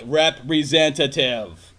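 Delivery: stretched slow single word; weary slow demand; slow, deliberate. Retold or imitated. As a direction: spell it out for the machine. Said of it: "And you're like".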